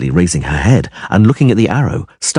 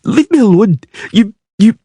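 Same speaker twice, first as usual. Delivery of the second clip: put-on high, louder spluttering child; stammering girl, much higher and louder; furious girl; 13 semitones higher, 4 dB louder than usual. A narrator's reading. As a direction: sputter with rage. Girl lashes out.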